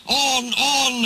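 high, drawn-out rallying yell. A narrator's reading. Trucks yelling to push Duck onward, high cries. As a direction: urge them on in a high voice. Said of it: "they yelled".

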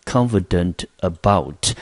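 confident about said slowly and carefully; slow down so students catch confident about; deliberate teacher's reading of confident about; slow model phrase. Shown as a demonstration. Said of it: "这个片语呢，同学就可以记一下".

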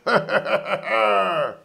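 roaring villain laugh; booming laugh. A narrator's reading. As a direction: laugh out loud and long. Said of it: "schallendes Gelächter". (schallendes Gelächter)

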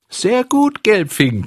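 very high, loud squeaky taunt; a tiny hidden voice shrieking the insult. Acted. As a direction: shriek the nickname like a cheeky little imp. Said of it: "kreischte ein feines Stimmchen".

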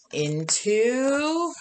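drawn-out into. A narrator's reading. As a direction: stretch "into" long and rising, like a cliffhanger. Suspense before page turn.

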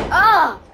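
loud high anguished shout; frustrated cry. Real, not acted. Pin broken.

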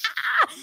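high mock scream; a squeal of shock; the kids shrieking at the TV; kids' scream. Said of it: "The kids are like".

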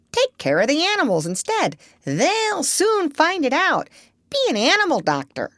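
high-pitched, insistent parrot; pushy parrot giving advice; bossy parrot. A narrator's reading. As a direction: urge the doctor on as a know-all bird. Voices Polynesia the parrot.

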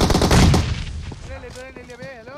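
yelling in real frustration, drawn out and slow; a real gamer's angry yell at teammates; frustrated shout. Real, not acted. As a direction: blast it at the team, slow and exasperated.

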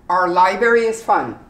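"Our" as a short R sound; r-colored our. Shown as a demonstration. Teacher modelling "Our" for learners. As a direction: drill it, "Our" without the O U. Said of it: "You do completely without the O U".

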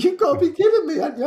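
can't believe what he's hearing; stunned disbelief. Retold or imitated. Telling the group thanks him, in high disbelief. His stunned reaction retold.

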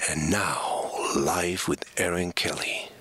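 low, slow, hushed announcer; voiceover intro. Pretend. Guest doing the show's intro on request, deep hushed announcer. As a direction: draw it out deep and whispery. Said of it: "you should be doing voiceovers".

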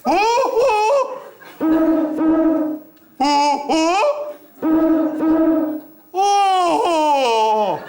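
long, drawn-out hoots; bird call hooting. Acted. Man in a tent chatting with a bird, hooting calls. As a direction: hoot back and forth like a night bird. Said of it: "senin bu kuşla diyaloğun nedir".

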